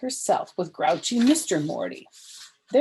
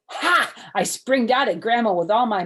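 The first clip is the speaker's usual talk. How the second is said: loud; 5 semitones higher, 9 dB louder than usual. Acted. Springing out at Grandma.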